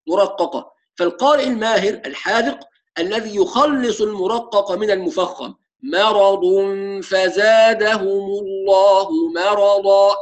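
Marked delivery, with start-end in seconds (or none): 5.8-10.1 مرض with a light mim, slow Quranic recitation; correct recitation. Shown as a demonstration. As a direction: recite مرضا slowly, thin mim before heavy ra. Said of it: "هكذا يكون التدريب".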